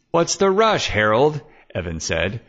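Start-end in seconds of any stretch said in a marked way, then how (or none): 0.1-1.4 mock surprise. Evan challenges Harold leaving.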